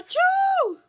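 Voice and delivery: high, loud sneeze burst; big sneeze. Acted. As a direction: let the sneeze explode out. Acted sneeze.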